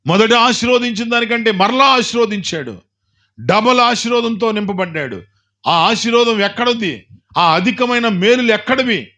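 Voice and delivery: high, ringing preacher's call; preaching at full force. Real, not acted. Preacher urging the church to hold on through suffering, ringing and fervent. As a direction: lift the congregation with rising fervour. Preacher extolling Job's blessing.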